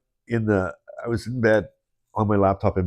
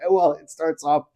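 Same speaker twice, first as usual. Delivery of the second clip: laughing; 7 semitones higher, slower than usual. Real, not acted. Host still laughing.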